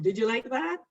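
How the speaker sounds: usual speaking voice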